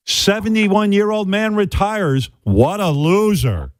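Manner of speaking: drawn-out mock announcement of a loser; mock headline voice. Ridiculing the news about a retired man, in a slow sneer.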